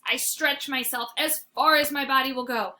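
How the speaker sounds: plain reading voice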